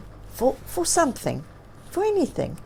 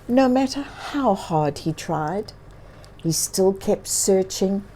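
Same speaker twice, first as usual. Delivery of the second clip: usual speaking voice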